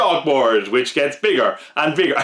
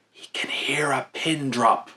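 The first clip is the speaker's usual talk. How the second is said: hushed, slow whisper on the last words; 6 dB quieter, slower than usual. Pretend. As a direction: breathe it out like a secret. Describing super-sensitive hearing.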